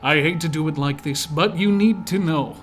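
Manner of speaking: melodic narrator voice. Shown as a demonstration. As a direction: read it like a tender angelic narrator. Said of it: "I shall read it using my melodic, angelic, harmonic narrator voice".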